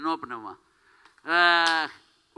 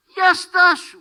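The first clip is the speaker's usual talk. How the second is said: sudden shout; 10 semitones higher, 9 dB louder, slower than usual. Acted. Sudden shout stopping someone.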